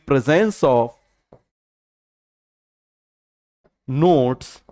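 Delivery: long pauses between each phrase, drawn out; slow dictation with pauses. Shown as a demonstration. Teacher dictating phrase by phrase, pausing for students. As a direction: give it phrase by phrase, waiting for them to write. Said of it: "बताओ जरा, हम क्या बोलेंगे?".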